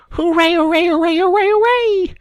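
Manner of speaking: high, loud rising hoorays; excited cheering. Acted. Rat dancing a jig in the snow, cheering. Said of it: "Suddenly the Rat cried".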